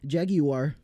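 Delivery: Jaguar as a mock-British "JAG-ee-war"; UK pronunciation. Shown as a demonstration. Streamer teasing UK chat, Jaguar said "JAG-ee-war". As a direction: play up Jaguar in three syllables, "JAG-ee-war". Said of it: "however people say it in the U K".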